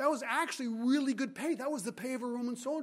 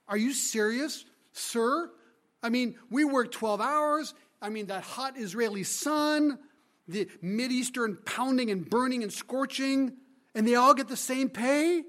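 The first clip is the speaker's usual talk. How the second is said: indignant grumbling; 3 semitones higher, 4 dB louder than usual. Acted. Workers who toiled twelve hours protesting equal pay, indignant. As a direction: protest with mounting outrage, hammering the hardships. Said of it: "I understand they're grumbling".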